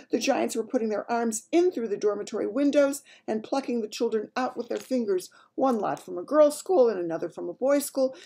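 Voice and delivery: high, loud, dramatic royal voice; shaken Queen. Acted. Queen recounting her dream to Mary, shaken. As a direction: relive the horror as an agitated Queen.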